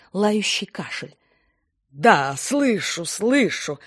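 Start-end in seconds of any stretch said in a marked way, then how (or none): 1.9-3.8 loud, very slow, annoyed; irritated. A narrator's reading. Secretary irritated at Rina's coughing, loud and drawn out. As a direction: snap back, drawn out and loud. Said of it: "с раздражением отозвалась Виолетта".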